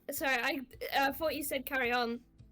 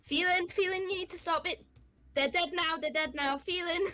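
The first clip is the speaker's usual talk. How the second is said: high, urgent pleading to a frenzied friend; 8 semitones higher, faster than usual. Acted. Briar pleading with Phelan to stop stabbing, high and urgent. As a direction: call her name high and desperate to snap her out.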